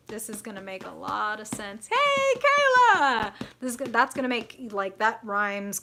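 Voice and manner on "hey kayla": high, loud, stretched-out hello; big sing-out holler; excited greeting. Real, not acted. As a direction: call out gleefully to a friend arriving. Calling out greeting to viewer.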